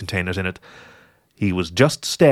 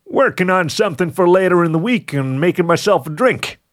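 higher-pitched, animated fox character; fox explaining himself; 8 semitones higher than usual. Acted. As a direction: brightly cover up with an excuse.

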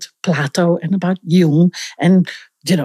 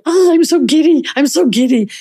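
mock giddy gushing, higher pitch; 7 semitones higher than usual. Acting someone who is always giddy, mock gushing. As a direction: gush lightly as if giddy. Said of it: "if you're always touched by".

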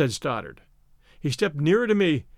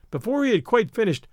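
plain reading voice

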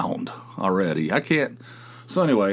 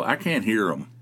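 normal talking voice

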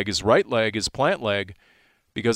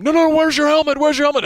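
high frantic shouted alarm, much louder; yelling; 7 dB louder, faster than usual. Retold or imitated. Sideline guys yelling at a helmetless kicker, frantic shout. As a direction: shout it at the kicker like he's about to forget. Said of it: "guys are yelling".

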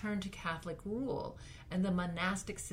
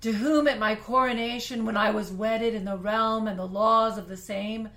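big period-drama voice; 3 semitones higher, 11 dB louder than usual. Pretend. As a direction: declaim like a queen rallying her subjects. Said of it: "I'm gonna try to make a big voice like they do in period dramas".

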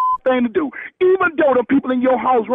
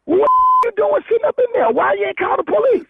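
alarmed; 8 semitones higher than usual. Real, not acted. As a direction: demand it in disbelief. Friend alarmed at caller.

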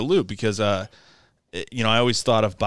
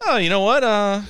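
much higher, louder sly voice of a squirrely guy; sly high-pitched squirrely guy bragging; squirrely buddy; 10 semitones higher, 4 dB louder than usual. Retold or imitated. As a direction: play the shifty buddy who beat the deal, high and loud. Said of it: "was kind of a squirrely guy".

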